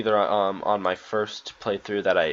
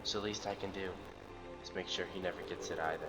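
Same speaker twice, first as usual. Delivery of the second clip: lightly acted, soft and restrained; subdued game character; 9 dB quieter than usual. Captain pleading that they can fix this, subdued. Reads hushed game dialogue.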